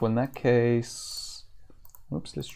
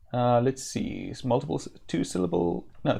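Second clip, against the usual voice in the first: usual speaking voice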